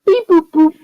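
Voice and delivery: high beeping droid noise; robot beeps. Acted. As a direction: beep and boop like a droid. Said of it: "I'm calling nine oh".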